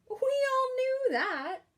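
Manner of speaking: high, loud, slow knowing voice; teasing friend. Acted. Friend teases Kelp, high and drawn out. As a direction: tease him like it's obvious.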